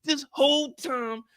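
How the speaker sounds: high, slow, outraged shouting; furious rant. Real, not acted. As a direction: hammer each accusation.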